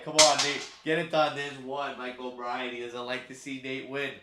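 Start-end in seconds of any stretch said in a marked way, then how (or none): 0.0-1.4 loud cheer for his horse. Real, not acted. Streamer cheering his horse to the finish, loud. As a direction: yell him home.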